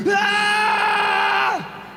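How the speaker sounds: high yelled Ah; quoted battle yell. Retold or imitated. Quoting his favorite game line with a high yell. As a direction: belt out a sudden high cry. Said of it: "My favorite line was".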